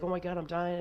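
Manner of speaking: high-pitched mock distress; high, urgent cry without full volume; retold frantic panic. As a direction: imitate your brother panicking in a game. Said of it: "it was really frantic".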